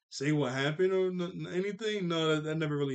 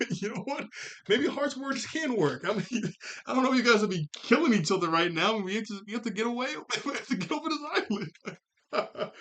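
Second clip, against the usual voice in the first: laughing panic; 7 semitones higher than usual. Retold or imitated.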